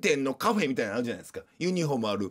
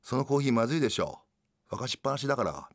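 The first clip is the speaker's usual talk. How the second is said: mildly lowered, off-duty voice; no high tone; 4 semitones lower, faster than usual. Acted. Mother home from work remarks on coffee, lightly lowered voice. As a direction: let the service pitch drop away, a bit low. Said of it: "さっきまでの高音一切ないんですよ".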